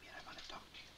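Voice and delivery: hushed late-night whisper. Real, not acted. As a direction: whisper so nobody wakes. Waking someone late at night.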